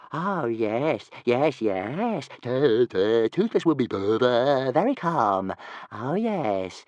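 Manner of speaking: a small excited dragon tripping over his words; little dragon voice. Acted. Toothless eager, stammering promise.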